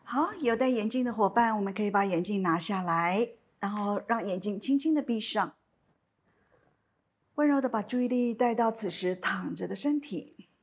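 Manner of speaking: calm, unhurried meditation guidance with long pauses; guided meditation. Acted. Teacher guiding listeners lying down in a body scan, calm meditation voice.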